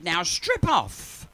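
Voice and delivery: slow, commanding exercise-tape voice; mock fitness instructor voice. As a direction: deliver it like a workout tape.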